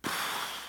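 short, soft, tired sigh out; weary sigh. Shown as a demonstration. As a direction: sigh along with the congregation. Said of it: "Can you relate to that?".

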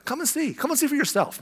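high, soft coaxing voice; coaxing invitation. Acted. Philip inviting Nathanael to come see, coaxing.

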